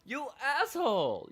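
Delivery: high, loud accusation; exasperated yell. Pretend. Player blaming game character for the trouble, exasperated shout. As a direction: yell it back, playfully outraged.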